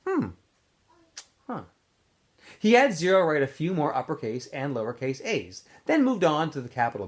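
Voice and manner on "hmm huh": ordinary delivery, nothing marked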